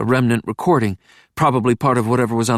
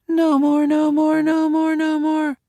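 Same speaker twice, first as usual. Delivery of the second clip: put-on higher, monotone child's chant; droning child. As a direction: drone it like a worn-out kid. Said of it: "The child voice, droning".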